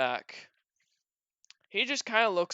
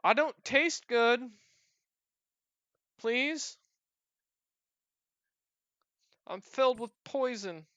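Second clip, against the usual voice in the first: high-pitched, slow goblin plea; pleading goblin; 9 semitones higher, 3 dB louder, slower than usual. Acted. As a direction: beg not to be eaten, pitched up. Plays the frightened goblin.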